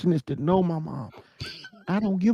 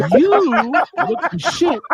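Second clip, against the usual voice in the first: retold angry retort; 11 semitones higher, 5 dB louder, much slower than usual. Retold argument with his mom.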